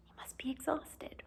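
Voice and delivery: soft unvoiced whisper. Remarking on the sleeping professor in a soft whisper. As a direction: breathe it so he stays asleep.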